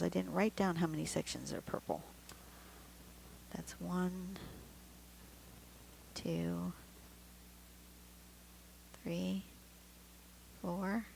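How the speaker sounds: quiet counting under her breath; muttering to herself. Real, not acted. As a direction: count softly to yourself.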